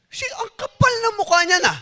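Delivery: high, loud outraged demand with a laugh breaking in; David fumes at the rich man in Nathan's story, loud and high; angry king. Acted. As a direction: bark out the question like an insulted king. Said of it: "Galit na galit doon sa lalaki".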